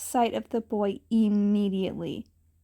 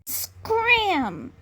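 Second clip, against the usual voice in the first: hiss; 8 semitones higher, 3 dB louder than usual. A narrator's reading. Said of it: "The last word came out like a hiss".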